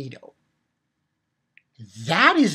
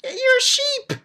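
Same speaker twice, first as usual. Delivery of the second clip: mocking villain; 4 dB louder, slower than usual.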